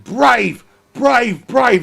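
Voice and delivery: rising, shouting the word brave; excited commentary. Real, not acted. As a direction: build the hype with each brave. Commentator hyped during an overtake.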